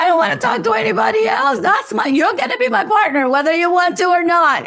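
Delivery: put-on a playful take on a mother claiming her child; mock smothering mother. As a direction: boss him around like a smothering mother. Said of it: "completely mothering him".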